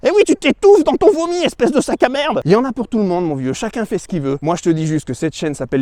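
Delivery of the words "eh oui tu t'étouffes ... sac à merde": mock insult. Insulting a critic.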